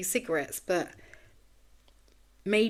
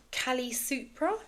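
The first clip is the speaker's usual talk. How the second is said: "Kali Supra" drawn out slowly with a questioning rise on Supra; unsure pronunciation; 6 semitones higher, slower than usual. Real, not acted. Podcaster trying out an unfamiliar chart term, "Kali Supra" said as an unsure question. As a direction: try out "Kali Supra" slowly, pitch climbing as if asking. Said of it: "That's how you pronounce it?".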